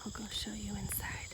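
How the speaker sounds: soft tour-guide whisper. Real, not acted. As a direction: whisper so nobody hears. Quiet tour of ceremony space.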